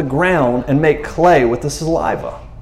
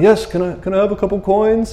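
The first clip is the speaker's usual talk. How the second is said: higher, meek beggar asking for coins; 5 semitones higher than usual. Retold or imitated. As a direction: play a timid beggar pleading, pitched up. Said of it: "I don't know if he talked like that".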